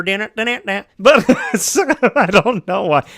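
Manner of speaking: put-on high, loud old world country accent. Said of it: "now that old country voice coming out here".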